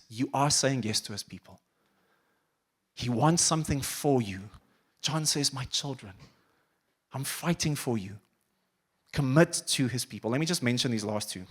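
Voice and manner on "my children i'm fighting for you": hushed tender plea. Retold or imitated. John's letter voiced tenderly.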